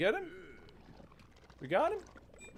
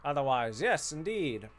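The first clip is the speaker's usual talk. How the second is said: a hint of old-film transatlantic accent; mild posh transatlantic accent. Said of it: "transatlantic accent".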